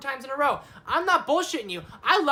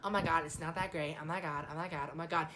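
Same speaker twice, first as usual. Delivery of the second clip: lower, softer mock whine; mocking critics; 8 semitones lower, 10 dB quieter than usual. Mocking people who call the movie not that great, dull whine. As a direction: sneer at the naysayers in a dull whine. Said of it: "A lot of people go".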